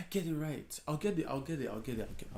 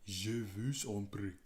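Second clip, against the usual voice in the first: lower, put-on French accent for je vous en prie; 4 semitones lower, 4 dB quieter than usual. Shown as a demonstration. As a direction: try to sound like a native French speaker. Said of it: "I need to have that accent".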